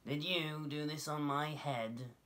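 high, slow, accusing mole voice; little mole asking. A narrator's reading. Mole questions the passing dove, slow high accusing voice. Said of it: "he asked the pig".

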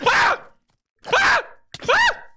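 short, very high battle cries; loud, playful fighting shouts; high attack yells. Pretend. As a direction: yelp with each blow. Attacking the opponent in game.

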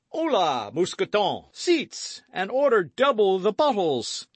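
high, hearty, booming host; boisterous Porthos. A narrator's reading. Porthos welcoming friends to dinner, boisterous. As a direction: play an expansive, boastful big musketeer.

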